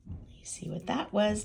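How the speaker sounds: plain reading voice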